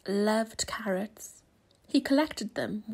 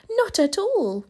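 eager rabbit; 8 semitones higher, 6 dB louder than usual. A narrator's reading. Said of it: "said Rabbit".

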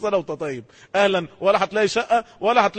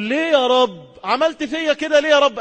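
mock complaint to God; 8 semitones higher, 4 dB louder than usual. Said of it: "مش أنت اللي هتطلع تقول".

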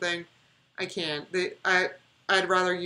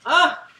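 sudden high gasp then a sharp loud ah; jolted by a spider on the screen; startled gasp and cry; 10 semitones higher, 10 dB louder than usual. Real, not acted. A spider pops up on screen, real startled gasp.

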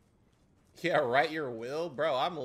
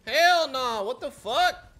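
loud outburst; 7 semitones higher, 7 dB louder, slower than usual. Real, not acted. Reacting to boss.

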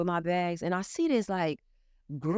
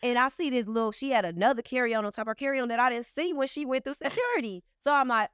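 ordinary delivery

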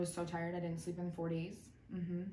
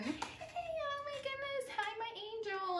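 fake sweet; slower than usual. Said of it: "tries to be all cute and exciting".

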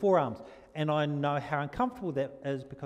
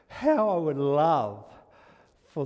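slow emphatic longing; 7 dB louder, slower than usual. Acted. Preaching with fervour.

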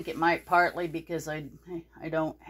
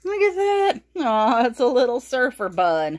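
cooing; 5 semitones higher, 4 dB louder than usual. Real, not acted. Cooing over a cute toy.

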